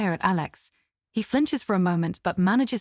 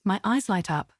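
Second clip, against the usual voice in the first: usual speaking voice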